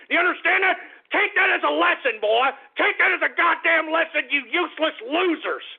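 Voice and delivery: loud, high berating yell; high-pitched, scornful outburst; angry shouting. Real, not acted. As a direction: shout the lesson at them. Lecturing listeners he despises.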